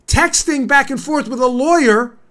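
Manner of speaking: indignant rant. Real, not acted. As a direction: build an outraged case against a senator. Indignant rant at Mark Warner.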